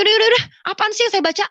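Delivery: high, loud, rapid outburst; retold exasperation. Woman giving in to the nagging voice, exasperated. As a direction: blurt it out fed up, fast and high.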